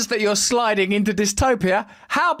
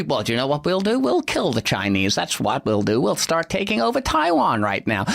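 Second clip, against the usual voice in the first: much lower, smug mock official; mock government voice; 8 semitones lower than usual. Mocking leaders who threaten China, in a low smug voice. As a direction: parody a gloating leader. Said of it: "like bloody China when they".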